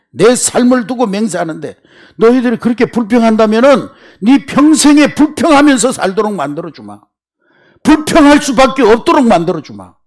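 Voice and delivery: high, loud stern warning; stern God. Acted. God warning grumbling Israelites, stern and loud. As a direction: warn them like an angry God.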